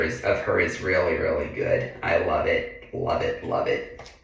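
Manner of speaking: soft, breathy-free hush, fading into a whisper; hushed admiration. Real, not acted. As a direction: murmur your awe at the picture. Admiring photo, voice sinking away.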